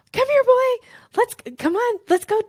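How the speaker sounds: high-pitched, loud, excited call to a dog. Retold or imitated. Coach shows how you call a dog, loud and high-pitched. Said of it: "high-pitched, like, aggressive, kind of fun, loud thing".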